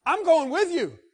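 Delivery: healed man clinging to Jesus's boat, eager. Retold or imitated. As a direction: beg to come along. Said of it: "And the guy says".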